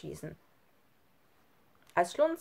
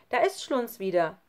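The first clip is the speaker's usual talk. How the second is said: calling out; 4 semitones higher, 4 dB louder than usual. Acted. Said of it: "rief Sami".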